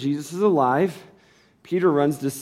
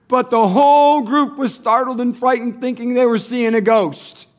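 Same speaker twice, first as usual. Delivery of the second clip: scripture reading that builds louder and higher; 5 semitones higher, 6 dB louder than usual. Acted. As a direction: read the passage, climbing toward the climax. Reads scripture aloud, rising.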